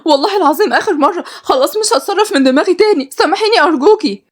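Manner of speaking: high-pitched begging for forgiveness; desperate pleading. Acted. Servant begs her mistress to forgive her, desperate pleading.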